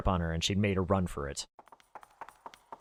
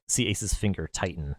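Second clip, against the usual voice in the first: ordinary delivery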